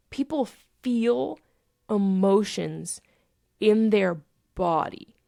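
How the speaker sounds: slow, spaced-out incredulity; retelling it in stunned slow motion; retold disbelief. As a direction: draw out each word, mind blown.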